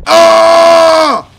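long, loud high yell; rage roar. Pretend. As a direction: let out a sustained battle roar. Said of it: "bring on rage mode".

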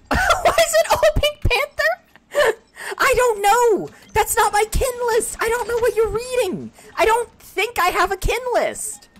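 high, loud laughter through every word; laughing hard. Real, not acted. Streamer laughing at Cortana's kin list, laughing hard. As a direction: crack up while protesting.

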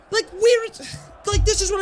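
high, loud burst of disbelief; frustrated yell. Real, not acted. Ranting gamer shouting at offside striker, high frustrated yell. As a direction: shout it out in pure exasperation.

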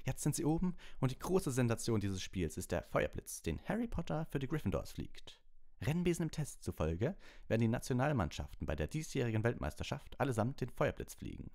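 high, rushing play-by-play; schoolboy commentator hyping the Firebolt; eager commentator. A narrator's reading. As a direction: gush about the new broom. Match commentator on the loudspeaker.

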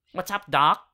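a loud, light cartoon rabbit catchphrase; loud, lightly voiced wisecracking cartoon bunny; Bugs Bunny. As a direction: toss it off like a cocky cartoon rabbit. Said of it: "that's my Bugs Bunny impression".

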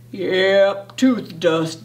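low, slow-talking dad figure; low fatherly pronouncement; dad's voice. A narrator's reading. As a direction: say it low, like a matter-of-fact dad. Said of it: "Dad all said".

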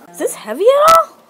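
very high, loud question; excited squeal. Real, not acted. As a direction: squeal it with delight. Lifting the new laptop.

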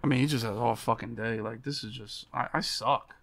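ordinary talk

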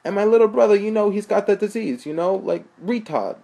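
put-on high, loud New York accent; brash New York street guy. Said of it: "Joe said in a New York accent".

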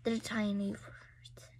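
breathy whisper, words barely voiced. Real, not acted. Child whispering to parent about tiny words. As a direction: hush it like a secret.

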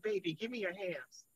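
soft, low, soothing; hushed coaxing of someone in tears; tender comforting. Retold or imitated. Comforting a crying woman, retold.

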